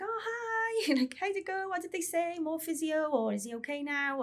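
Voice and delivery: bright mock phone greeting from the husband; cheery husband. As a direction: play the husband chirpy and unaware. Husband answers phone, unaware and cheerful.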